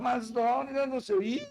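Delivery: high, offhand mock brush-off; dismissive reply. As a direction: imitate a member shrugging off the pastor. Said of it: "Tem onde que fala". (resposta desdenhosa, voz aguda de imitação)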